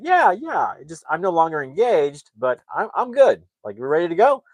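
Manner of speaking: high, strained insistence of being fine; too-eager, high-pitched reassurance, imitated; mock brave face. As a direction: act out the jilted instructor pretending all is well. Retold jilted man insists he's fine.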